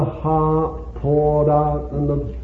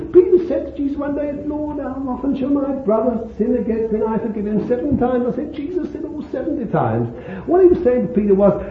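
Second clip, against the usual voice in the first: fast, high, tumbling excitement; impassioned preaching; 6 semitones higher, 4 dB louder than usual. Acted. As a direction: preach it in a rush of wonder, voice leaping up. Preaching at full force.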